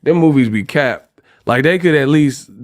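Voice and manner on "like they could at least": normal talking voice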